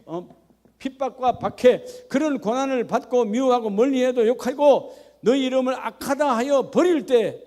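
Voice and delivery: impassioned preacher building to a peak; full-force preaching. Acted. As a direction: preach it out with fire.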